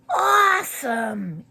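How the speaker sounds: put-on a kid bursting with delight; thrilled. A narrator's reading. Boy reacts to the shrunken head, slow and thrilled.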